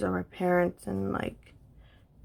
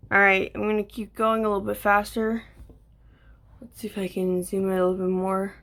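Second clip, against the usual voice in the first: usual speaking voice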